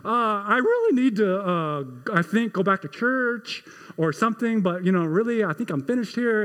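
a mild, half-acted tearful stammer; workman breaking down. Pastor imitating the workman falling apart after the boy's question, mild mock crying. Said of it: "he just starts disintegrating".

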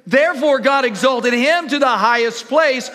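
loud, high, building scripture reading; preaching at full force. Acted. Pastor booming scripture to the congregation, rising preach. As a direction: proclaim it to the whole church.

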